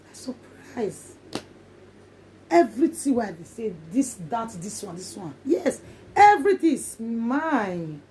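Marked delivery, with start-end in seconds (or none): 0.1-1.1 slow unvoiced whisper of awe; awed whisper. Real, not acted. Recalling her miracle, slow awed whisper. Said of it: "awed recall". 5.4-8.0 slow deliberate claim; slow triumph. Real, not acted. Claiming her blessing, slow and triumphant. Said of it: "triumphant claim".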